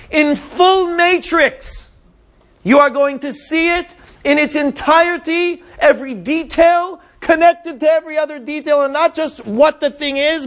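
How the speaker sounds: high, loud, emphatic preaching at full force; impassioned preaching. Acted. Teacher voicing God's promise to Moses, impassioned preaching. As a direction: drive each phrase home, high and loud.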